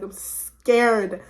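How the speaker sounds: SCARED drawn out slowly; emphatic. Retold or imitated. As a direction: stretch the word scared for emphasis. Stresses how scared she was.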